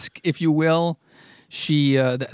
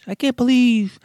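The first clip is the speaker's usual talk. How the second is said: higher, mock-behaved Trump voice with a far-off timbre; imitating Trump; 7 semitones higher than usual. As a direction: do Trump trying to stay calm, pitched up. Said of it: "'cause he talks like this".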